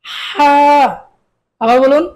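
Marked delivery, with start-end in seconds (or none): 0.1-0.5 হা pressed from the throat, a tight breathy h; throaty ha. Shown as a demonstration. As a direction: squeeze হা from the throat. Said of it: "গলায় চাপ দিয়ে তারপর আদায় করতে হবে". (গলায় চাপ দিয়ে হা)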